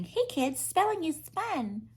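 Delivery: high, quiet mock of a cutesy singer; soft sing-song parody. Mocking a grown woman's cutesy lyric, high. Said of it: "a grown woman saying".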